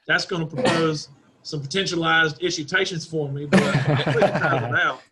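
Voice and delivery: put-on folksy Texas good-ol'-boy talk; Texas accent. Driver boasting about rallying abroad, in a Texas accent. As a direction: lay on a Texas twang. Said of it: "[name], your Texas is showing".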